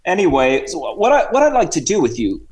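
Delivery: chatty put-on Cockney-Australian accent; Sir Reginald. Guest introduces the hosts to sport in fake Cockney-Australian accent. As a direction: ramble as a mock Cockney-Aussie guest. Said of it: "bit of a Cockney accent and Australian".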